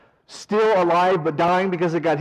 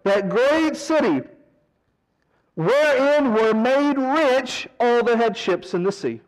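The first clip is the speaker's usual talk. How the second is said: mock wailing; 5 semitones higher than usual. Preacher voicing merchants mourning their burned city, mock wailing. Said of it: "they're wailing, and they're saying".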